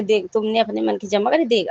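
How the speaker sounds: normal talking voice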